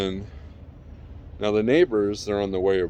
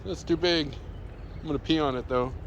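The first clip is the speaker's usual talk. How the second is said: lightly voiced dog, a bit higher; a hint of a cheeky pup talking; voicing the dog; 5 semitones higher, 4 dB quieter than usual. Owner speaking for the dog about the big stick, lightly voiced. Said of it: "He's like".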